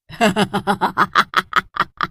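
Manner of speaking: gloating witch laugh; evil witch cackle. Acted. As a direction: cackle in triumph. Witch laughs after threat.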